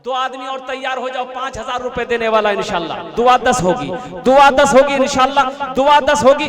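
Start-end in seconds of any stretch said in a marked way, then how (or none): 3.2-6.5 urging call. Real, not acted. Urging donors.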